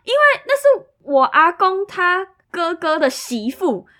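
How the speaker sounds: 大声高亢地说出; 激动地放慢说清关系; loud excited reply. Retold or imitated. Coworker reveals family link. (同事激动地大声解释亲戚关系)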